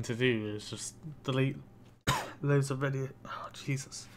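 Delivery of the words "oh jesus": ordinary talk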